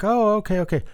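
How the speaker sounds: high-pitched, acted-out "just noticed" reaction; mock realization. Host imitating someone looking at the detail, mock realization. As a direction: play an onlooker taking it in. Acts onlooker eyeing the detail.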